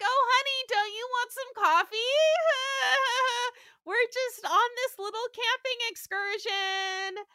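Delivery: high chirpy hostess voice; sweet housewife voice. Retold or imitated. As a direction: play the too-sweet picnic wife, laughing along. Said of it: "She's like".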